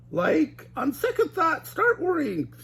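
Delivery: high panicky Shaggy; change your mind in a panic; high scared cartoon teen; Shaggy crying out. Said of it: "cried Shaggy".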